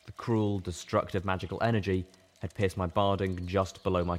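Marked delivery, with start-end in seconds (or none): none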